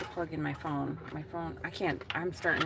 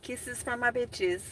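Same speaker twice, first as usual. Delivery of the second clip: mock sweet; 6 semitones higher, slower than usual. Mock affection to trolls.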